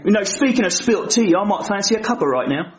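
put-on cheeky cockney-style British accent; mock London accent. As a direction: lay the British accent on thick. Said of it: "I don't like his accent".

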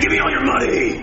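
robbery demand. Acted.

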